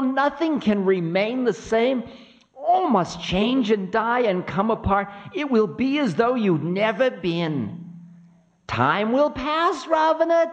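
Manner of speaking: very high, insistent prophetic voice; high-pitched wailing prophecy; Time, doom-laden. Acted. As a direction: pronounce doom, high and relentless, slowing at the end. Time pronounces doom on Ravana.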